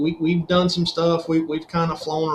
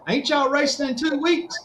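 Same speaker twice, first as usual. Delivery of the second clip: much higher-pitched mock disbelief; incredulous retold question; 9 semitones higher than usual. Shop visitor questions the crew about a late motor, imitated incredulity.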